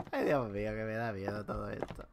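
ordinary talk, nothing marked

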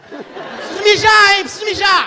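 slow, high, loud call into the dark; hammed-up loud warning; loud announcement. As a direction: shout it so the nun hears and leaves. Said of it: "Falei alto pra ver se a freira vazava".

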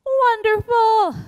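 high, loud, slow cheer; excited praise. Real, not acted. Instructor praising dancers after a pose, excited. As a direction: cheer the class on.

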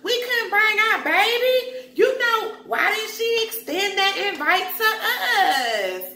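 hammed-up high complaining about the baby; mimicking Portia. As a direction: whine it like you were left out. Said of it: "She's like".

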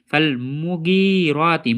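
slow মুগিরাতি, short মু and long গি; correct elongation. Shown as a demonstration. Teacher showing where the stretch belongs, correct elongation. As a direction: pass quickly over মু, stretch গি in মুগিরাতি. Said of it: "মুগি গাইনে টান হবে".

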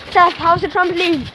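usual speaking voice

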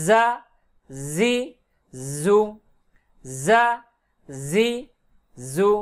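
"জা, জি, জু" with a long light z; thin buzzing za. Shown as a demonstration. Teacher demonstrates za with thin sound, buzzing pronunciation. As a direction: hold a thin z buzz before each of "জা, জি, জু". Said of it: "ঝিরঝির পাতলা আওয়াজ".

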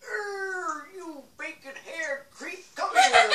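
put-on high, slow creepy old man. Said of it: "my old man Jenkins voice".

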